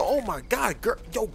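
ordinary talk

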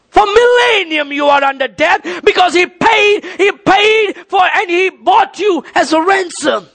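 high, insistent pulpit questioning and answer; full-force preaching. Real, not acted. Preacher telling congregation Christ paid their debt, full-force preaching. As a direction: press it home, pitched high.

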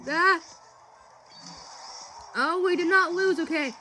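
high loud thrilled shrieks; shrieking jackpot winner. Real, not acted. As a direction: shriek with joy at the win. Tense finish and win.

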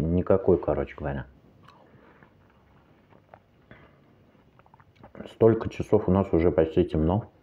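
chewing while talking; finishing a bite of melon; mouth partly full. Real, not acted. Seen 0: Melon eaten at -4; chewing, pursed lips, fork held until 3.2.

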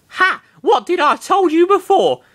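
put-on high gloating girl voice; triumphant whisper. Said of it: "whispering triumphantly".

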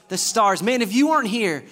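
ordinary talk, nothing marked

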